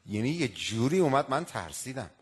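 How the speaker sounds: ordinary talk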